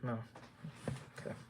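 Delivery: usual speaking voice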